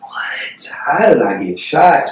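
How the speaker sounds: slow, breathless whisper of shock; whispered disbelief. Retold or imitated. Discovering his own gunshot wound, slow stunned whisper. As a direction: whisper it like you can't believe it. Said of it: "I'm like".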